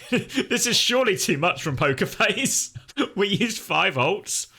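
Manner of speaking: amused disbelief, laughing through words. Real, not acted. Laughing at enemy ults.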